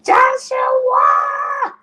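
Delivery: loud high call of the name across the room; yelled name. Retold or imitated. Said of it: "I yelled your name out".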